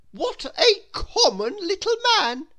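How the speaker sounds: very high, loud scornful laugh in the voice; snooty Miss Splendid. Acted. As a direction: sneer at the common little man. Snobbish thought about Mister Small.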